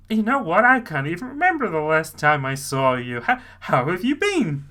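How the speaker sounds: ordinary talk, nothing marked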